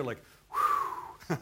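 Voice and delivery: long blown-out "whew"; sigh of relief. Acted. Preacher imagining the disciples' relief at hearing Jesus, sighing with relief. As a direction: exhale an acted, relieved whew. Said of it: "sigh of relief".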